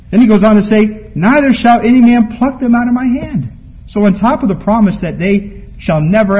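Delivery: high, loud, fervent preaching; full-force preaching. Acted. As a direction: lift it high and drive home the promise.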